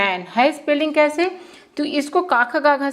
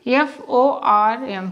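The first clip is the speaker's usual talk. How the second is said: slow letter-by-letter spelling; spelled out slowly; much slower than usual. Shown as a demonstration. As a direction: spell F O R M out one by one. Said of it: "जैसे आप लिखते हो form".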